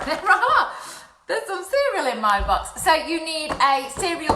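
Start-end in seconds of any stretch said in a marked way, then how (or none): 0.1-2.1 laughing. Real, not acted. Finds cereal still in box.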